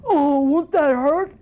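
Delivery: slow, high fretful troll; worried troll. Acted. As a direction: ask it slowly, worried.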